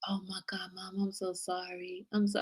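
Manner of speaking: hushed, aghast apology as her son; imitating remorseful son. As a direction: whisper it like a guilty kid. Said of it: "He felt so bad".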